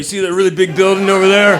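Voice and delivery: mock loud cop. Cop pointing out a big building, mock loud. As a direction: raise the voice to point something out.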